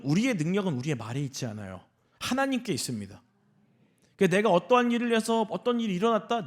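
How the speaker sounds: ordinary delivery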